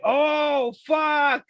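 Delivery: loud, high swear of alarm; a viewer seeing a kill coming, shouting in alarm; shocked shout. Retold or imitated. Reacting to a shocking death.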